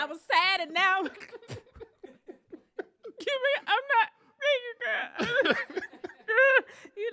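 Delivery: tearful laugh at her own story; laughing through tears. Real, not acted. Telling a friend, she laughs and cries at once. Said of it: "I was crying 'cause of".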